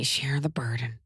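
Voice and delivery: whispered, menacing echo; sinister whisper. Retold or imitated. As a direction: whisper it like a villain. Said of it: "That's mean".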